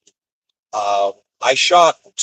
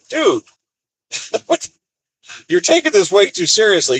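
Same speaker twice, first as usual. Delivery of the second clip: incredulous laugh; 9 semitones higher than usual. Retold or imitated. Laughing at the pissy worker.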